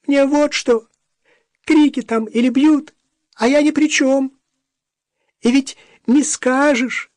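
very high, loud squeaky babble. Acted. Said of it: "писклявым голосом".

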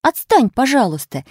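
put-on annoyed little girl; angry snap. A narrator's reading. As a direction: brush the governess off crossly. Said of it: "сердито оборвала её Буба".

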